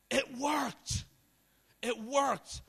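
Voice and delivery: high, full-voiced shout; excited shout. Thirteen-year-old freaking out at a miracle, shouting. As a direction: shout it out in high-pitched amazement. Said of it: "And when I shouted".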